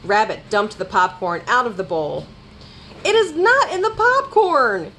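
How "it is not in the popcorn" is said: high, frantic storybook shout. A narrator's reading. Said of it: "he shouted".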